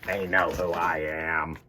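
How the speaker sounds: lightly grouchy, slow; a hint of a grumpy monster; Oscar the Grouch. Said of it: "grouchy green monster named Oscar".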